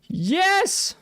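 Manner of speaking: gasp, then cheer it out; excited gasp and yes. Real, not acted. Finds homeward feather, delighted.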